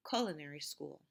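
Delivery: culinary stressed on CUL, falling after it; first-syllable stress. Shown as a demonstration. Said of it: "See if you can hear the stress".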